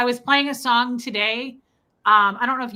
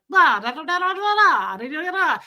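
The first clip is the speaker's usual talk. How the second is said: mock Italian gibberish, higher and louder; hammed-up sing-song imitation of fast Italian; Luigi; 6 semitones higher, 5 dB louder than usual. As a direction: babble like an excitable Italian in the movies. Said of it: "he does it like the movies".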